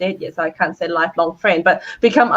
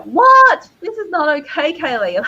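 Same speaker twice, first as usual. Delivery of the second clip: shocked what; 8 semitones higher, 3 dB louder than usual. Retold or imitated. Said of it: "He's like".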